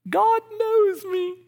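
very high, loud, slow sweet voice; cutesy children's-book voice; sing-song title. Acted. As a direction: read it like to a toddler. Reading cute book title.

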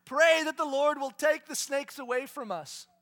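loud, high, sobbing shouted sorry; desperate apology. Retold or imitated. Bitten people to Moses, loud sobbing plea. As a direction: beg forgiveness in mock panic. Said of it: "a good time to go".

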